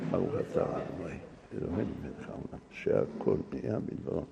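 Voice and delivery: voiceless blessing before drinking; whispered blessing. Real, not acted. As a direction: murmur the prayer under the breath. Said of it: "blessing over water".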